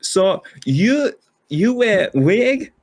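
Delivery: put-on high, slow German accent; slow, high-pitched German accent interviewer. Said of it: "a weird accent the whole time".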